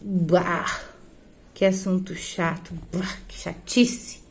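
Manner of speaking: soft, slow fed-up "Bah" fading into a near whisper; bored disgust. Shown as a demonstration. Teacher showing students how boredom sounds, sighing. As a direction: sigh it out, totally bored by the topic. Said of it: "Você também pode dizer".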